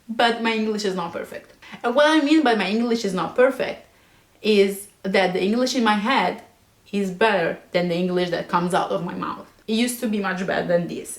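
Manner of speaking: ordinary talk, nothing marked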